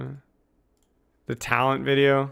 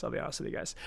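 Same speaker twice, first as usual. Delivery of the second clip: unvoiced confiding whisper, much softer; 3 semitones lower, 14 dB quieter, much faster than usual. Streamer whispers a confession to his viewers. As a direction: lean in and whisper it to chat. Repeats line, second take whispered.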